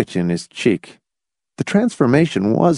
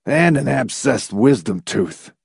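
defeated tone; 3 semitones higher than usual. A narrator's reading. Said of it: "in a totally defeated tone".

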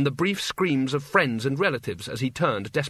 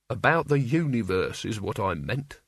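ordinary delivery

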